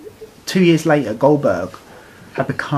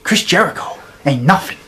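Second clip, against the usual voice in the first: mock Goldberg promo; 2 semitones higher, slower than usual. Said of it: "given this line".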